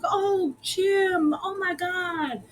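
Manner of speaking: high, drawn-out mock delight; retold delighted greeting. Riri seeing Jim at his shop, acted delight. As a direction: imitate greeting a long-lost friend.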